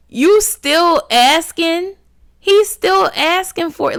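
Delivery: can't believe he's still going; shocked disbelief. Real, not acted. Vlogger reacting to the father still asking, shocked.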